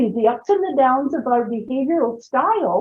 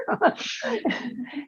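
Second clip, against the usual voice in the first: light laugh; 5 semitones higher, 11 dB quieter than usual. Real, not acted. Laughing at story.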